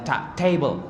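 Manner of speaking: ta alone, then table on that same t; initial ta in table. Shown as a demonstration. Teacher models ta at the start of table, crisp demonstration. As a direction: isolate ta and carry it into table. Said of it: "ta itu awalnya".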